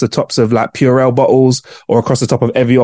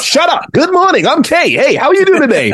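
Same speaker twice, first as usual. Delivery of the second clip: much higher, chirpy greeter voice; kooky cheery neighbour; 13 semitones higher than usual. Retold or imitated. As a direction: beam at passers-by. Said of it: "walking down the street going".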